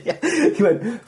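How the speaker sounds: usual speaking voice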